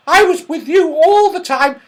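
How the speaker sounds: put-on very high and loud little girl; a cheeky little girl denying it; little Goldilocks. A narrator's reading. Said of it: "said Goldilocks".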